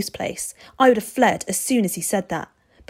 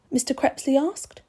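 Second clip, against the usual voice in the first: ordinary delivery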